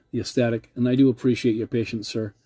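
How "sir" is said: normal talking voice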